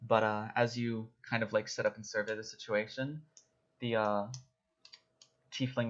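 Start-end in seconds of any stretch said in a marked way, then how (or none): none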